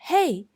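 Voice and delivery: "hey" sharp, high and loud, falling. Shown as a demonstration. Teacher repeats the warning word, loud and falling. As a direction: snap "hey" down like tone four. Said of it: "same tone as tone four".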